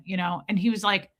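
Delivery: ordinary delivery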